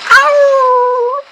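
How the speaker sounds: very high strangled cry of pain; pained howl. Acted. Character hit by a laser, high pained howl. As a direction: yelp as if struck hard.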